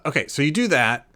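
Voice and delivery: ordinary delivery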